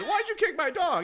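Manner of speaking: high, loud mock outrage; retold scream. Imitating the owner confronting the store manager, mock screaming. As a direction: screech it in imitation, laughing underneath. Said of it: "she starts screaming".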